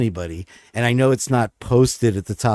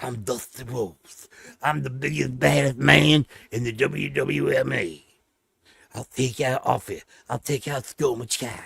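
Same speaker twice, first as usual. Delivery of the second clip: Dusty Rhodes impression; 5 dB quieter than usual. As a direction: lightly mimic a Southern wrestler. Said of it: "he talked like that".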